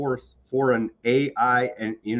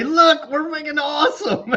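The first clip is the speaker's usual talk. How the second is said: laughing, high mock brag; a hint of smug tech bros, giggling; laughing mockery of boastful executives; 14 semitones higher, 3 dB louder than usual. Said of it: "and say".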